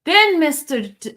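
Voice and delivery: normal talking voice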